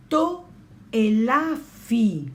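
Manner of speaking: To elafi drawn out slowly; modelled Greek word. Shown as a demonstration. Teacher modelling Greek word for class, slow. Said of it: "teacher models Greek word".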